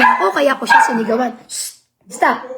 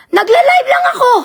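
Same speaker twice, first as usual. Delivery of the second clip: angry shout; 10 semitones higher than usual. Real, not acted. Shouting at the woman who accused her. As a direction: yell it back at the accuser. Ranting about being accused.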